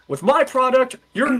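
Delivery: a high-voiced mock salesman; salesman pitch. Impersonating the pitching-machine guy selling his product, high mock salesman. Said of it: "he's like".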